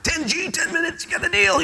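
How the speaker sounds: put-on young guy jumping at the boss's ten thousand dollar offer, thrilled; thrilled yes. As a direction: snap up the deal like you won the lottery.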